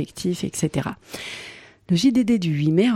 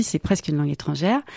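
usual speaking voice

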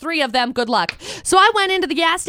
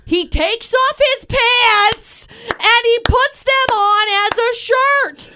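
higher, slowed and drawn out for the big reveal; excited punchline; 6 semitones higher, 3 dB louder, much slower than usual. Real, not acted. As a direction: drag out the punchline, louder. Punchline of the story.

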